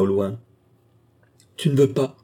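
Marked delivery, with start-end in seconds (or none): 1.6-2.1 high, desperate protest. A narrator's reading. Wife refused death by her husband's hand, desperate. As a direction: plead in despair, voice raised. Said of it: "dit-elle désespérément".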